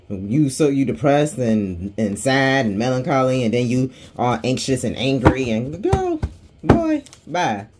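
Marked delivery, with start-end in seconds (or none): 5.8-7.7 high-pitched mock send-off; sassy dismissal. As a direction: shoo them away with pitch shooting up. Mock dismissal of the attention seeker.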